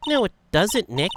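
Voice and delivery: high, loud objection; emphatic correction. Acted. [name] correcting Nick's reading, high and loud. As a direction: contradict sharply, pitched well up.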